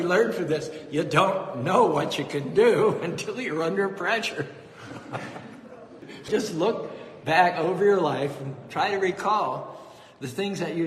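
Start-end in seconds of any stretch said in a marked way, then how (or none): 4.5-6.2 soft laugh. Real, not acted. Laughter after the punchline.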